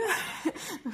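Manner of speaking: short high laugh, self-mocking; embarrassed laugh. Real, not acted. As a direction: laugh at yourself after the accent. Laughs at her own accent. (petit rire gêné et aigu)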